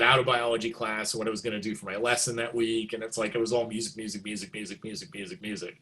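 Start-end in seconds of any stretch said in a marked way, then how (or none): 3.7-5.8 low, soft, rapid chant; absorbed muttering. Retold or imitated. Student daydreaming in biology class, absorbed muttering. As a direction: murmur it over and over, like a looping thought. Mimes mind filled only with music.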